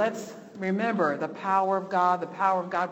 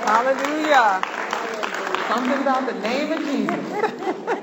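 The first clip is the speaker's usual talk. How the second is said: loud, higher cry of praise; rejoicing praise; 6 semitones higher, 5 dB louder than usual. Real, not acted. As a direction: lift it up in church joy. Church response to a testimony.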